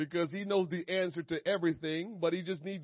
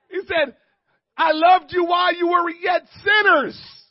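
high, loud preacher's shouting; impassioned pulpit holler; full-force preaching; 11 semitones higher, 14 dB louder than usual. Real, not acted. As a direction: shout the message across the church, stretching key words.

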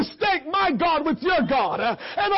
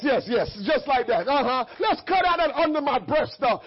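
ordinary delivery

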